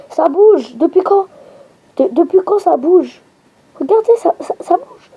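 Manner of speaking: voix qui monte très haut, étonnée; s'étonner en pointant l'écran; surpris. Real, not acted. Jeu qui bouge soudain. (surprise aiguë devant le jeu)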